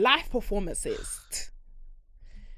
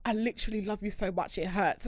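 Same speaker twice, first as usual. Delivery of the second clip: soft, imitating a drunk friend's earnest confession; mock-dramatic confession; 7 dB quieter than usual. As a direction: confess it quietly, as if pained. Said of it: "it was just so dramatic".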